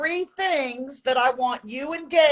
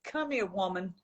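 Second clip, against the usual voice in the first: sultry voice; 5 semitones lower, 11 dB quieter than usual. Shown as a demonstration. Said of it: "I want you to say".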